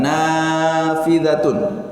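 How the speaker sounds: Nafidatun drawn out very slowly, each syllable clear; slow model reading. Shown as a demonstration. Teacher models the singular form slowly for the class. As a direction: Nafidatun spelled out in sound, dh plain. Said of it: "pakai dal".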